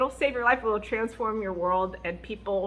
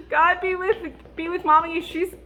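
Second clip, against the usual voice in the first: put-on child praying; 8 semitones higher, 7 dB louder than usual. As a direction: pray earnestly like a small child. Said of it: "they'll go".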